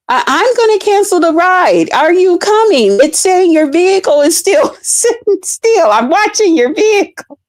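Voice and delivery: high, put-upon threat to cancel; annoyed passenger. Retold or imitated. Passenger threatening to cancel the ride, high and annoyed. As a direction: warn them you're done waiting. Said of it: "passenger threatens to cancel".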